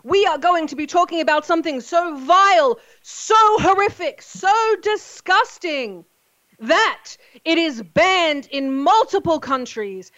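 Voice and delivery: booming ringmaster announcement, slow and loud. Acted. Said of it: "Ladies and gentlemen, boys and girls, children of all ages".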